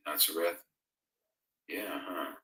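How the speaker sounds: normal talking voice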